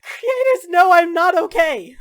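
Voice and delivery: high, loud, drawn-out cry; distraught yell; exasperated shouting. Acted. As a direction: wail it in angry frustration. Acted outburst, calling on gods.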